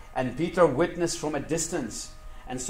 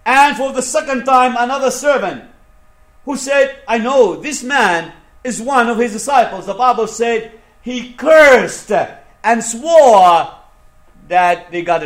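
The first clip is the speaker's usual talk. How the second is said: impassioned preaching; 6 semitones higher, 10 dB louder than usual. Acted. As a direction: preach the story with mounting force. Preaches Peter's denial at full force.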